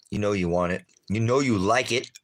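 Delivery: loud playful taunt, rising; teasing. Real, not acted. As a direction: tease them with the food. Teasing viewers with food.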